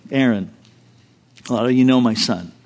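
normal talking voice

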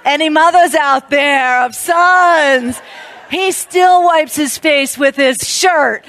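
loud laughing aside to the audience; slow, loud, laughing aside. Real, not acted. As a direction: laugh through the joke to the mothers.

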